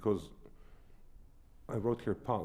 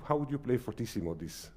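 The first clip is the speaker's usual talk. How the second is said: ordinary talk, nothing marked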